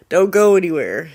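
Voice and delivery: warning viewers who see a blue light at home; loud warning. Real, not acted. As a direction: warn them to stay put.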